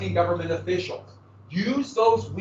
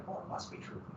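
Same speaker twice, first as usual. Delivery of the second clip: breathy whispered mock belief; mock gullible whisper; 19 dB quieter, faster than usual. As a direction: whisper it like a dupe who swallows the lie. Mocking gullible listener.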